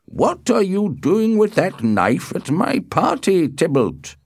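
high-pitched angry demand; indignant host challenging a guest. A narrator's reading. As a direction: demand an answer, pitch leaping up. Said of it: "he asked angrily".

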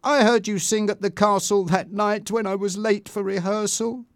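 upset storybook dialogue; Bianca, upset. A narrator's reading. Narrator voices upset Bianca.

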